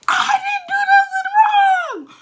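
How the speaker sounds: high, loud mock wail, stretched out slowly; tearful protest. Girl protesting innocence while apologizing, wailing. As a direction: imitate yourself bawling in self-defence. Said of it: "But I'm apologizing".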